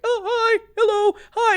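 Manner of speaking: very high, sweet little greetings; high-pitched polite hellos, imitated; imitating someone extra nice. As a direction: mimic a friendly star greeting everybody. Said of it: "he was being so nice".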